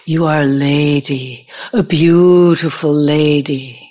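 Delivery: lingering, seductive imitation of a suitor; imitating his whisper. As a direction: mimic a sleazy European sweet-talker. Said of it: "whispered in my ear".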